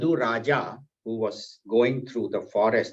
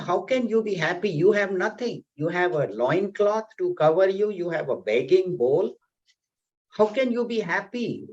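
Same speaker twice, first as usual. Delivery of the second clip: higher, faster, baffled questions; can't believe a man with nothing is happy; incredulous king; 6 semitones higher than usual. Acted. King questioning the laughing beggar, incredulous.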